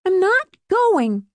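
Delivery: very high, slow refusal; defiant daughter. A narrator's reading. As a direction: dig in like an upset girl. Daughter digs in against mother.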